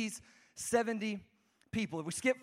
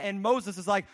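normal talking voice

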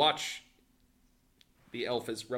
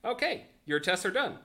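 lightly raised, higher official voice; examiner announcement; 7 semitones higher than usual. Acted. Game master tells players tests are done, examiner announcement. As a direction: call time on the test, a bit higher.

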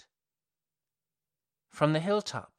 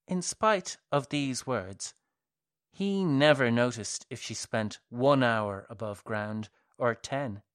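ordinary delivery

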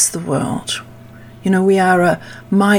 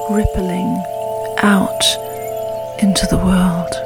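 calm grounding exercise. Acted. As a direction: guide them gently into stillness. Leads a meditation.

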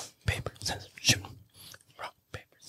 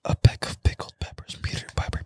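whisper; 6 semitones higher than usual. Real, not acted. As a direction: keep it breathy and hushed. Whispers all through.

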